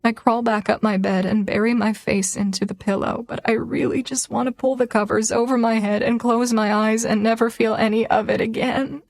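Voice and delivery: ordinary delivery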